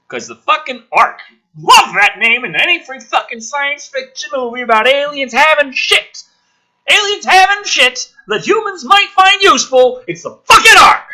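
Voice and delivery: loud, high angry outburst rising to a yell; angry rant. Real, not acted. Commentator raging at the Ark cliché so loudly his pet hides. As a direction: rage at the lazy sci-fi cliché. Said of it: "I'm not angry at you".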